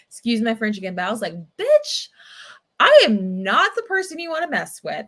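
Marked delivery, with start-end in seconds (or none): 1.6-5.0 shrieked high and loud on the first word, then held high and loud; shouting down the wife who called her little girl; angry comeback. Retold or imitated. Retold fight, she yells back at the wife.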